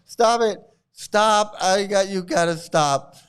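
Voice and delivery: ordinary delivery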